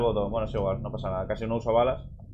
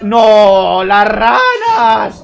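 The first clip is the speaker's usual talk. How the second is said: disgusted shout; 7 semitones higher, 9 dB louder, much slower than usual. Real, not acted. Frogs appear, disgust.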